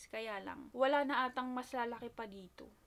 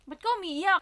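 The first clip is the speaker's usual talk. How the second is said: louder, higher anxious asking; worried questions; 5 semitones higher, 8 dB louder than usual. Retold or imitated. Asking her crying sister, louder worried. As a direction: press her sister with worry. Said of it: "Sabi ko".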